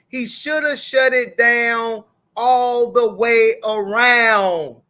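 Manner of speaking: loud, drawn-out stress on "shut it down all the way around"; emphatic repeat. Real, not acted. Host insisting he should have refused to talk to her, emphatic. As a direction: stretch "all the way around" slowly and loudly.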